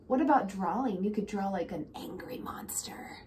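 ordinary delivery

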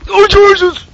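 high loud startled "oh Jesus"; startled shout. Real, not acted. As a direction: jump at the sight. Monster appears suddenly again.

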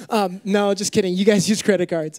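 usual speaking voice

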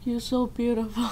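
hushed and spellbound, like he has lost his mind; a hint of a stranger lost in a daze; dazed, out of his mind. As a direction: murmur it as if entranced. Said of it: "giống như kiểu như là bị mất trí luôn á".

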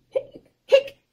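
high little cat hiccups; imitated hiccups. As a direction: squeak out two quick hiccups.

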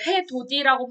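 해, 돋이 said as 해도디, with a plain d; d kept before the i in 돋이; unpalatalized 해돋이. Shown as a demonstration. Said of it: "발음 안 하고".

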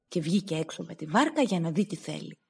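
ordinary delivery

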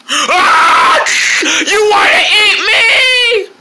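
high scream into drawn-out shriek; hammed-up horrified disbelief; terrified. Friend threatens to eat him.

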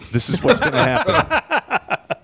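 laughing mock colonel. Teller laughing while quoting the colonel threatening Edwards. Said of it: "He goes".

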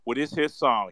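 ordinary delivery

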